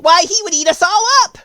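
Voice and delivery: high, loud squeaky alarm; a tiny queen terrified of a lion; alarmed cry. Acted. The mouse queen cries out in fright at the idea of a lion. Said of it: "cried the little queen".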